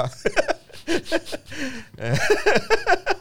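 squealing high laugh; real high-pitched laugh; high burst of laughter. Real, not acted. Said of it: "หัวเราะทำไม".

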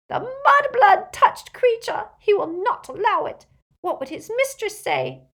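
high, loud, outraged elf; repulsed house-elf. Acted. Elf recoils from the girl's touch, repulsed outcry. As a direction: shrink back in horrified outrage, high-pitched.

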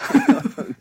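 short high-pitched laugh; high squeal laugh. Real, not acted. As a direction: let a squeaky laugh escape. High laugh after quote.

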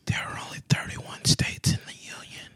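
hushed conspiratorial whisper. Acted. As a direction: whisper it like a secret. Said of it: "Why are you whispering?".

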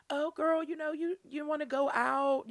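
put-on high-pitched girlfriend invitation; coaxing cousin. Cousin asking her to go out tonight, coaxing. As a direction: imitate a cousin pitching a night out.

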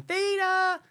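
high-pitched mimicking cry of "Theta!"; character's high call. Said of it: "She's always saying".

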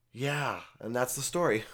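ordinary talk

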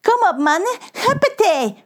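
high, clapping cheer; rally the crew with glee; enthusiastic. Acted. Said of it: "klapt Pedro enthousiast". (Pedro enthousiast aanmoedigend)

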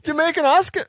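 put-on Jamaican accent. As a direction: attempt a Jamaican accent, laughing. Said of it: "could do a kick-ass Jamaican".

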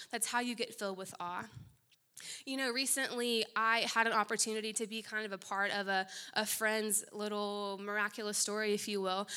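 ordinary delivery

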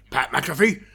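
loud boss's bellow, rising to a high pitch; mock-furious shouted impression, drawn out; Vince McMahon. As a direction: bellow it like an irate boss firing someone. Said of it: "you could hear Vince McMahon".

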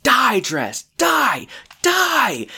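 high, rising shriek; furious shout. Retold or imitated. Bridesmaid beats the dress with a parasol, shrieking. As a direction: attack the dress in a rage. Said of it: "she's like".

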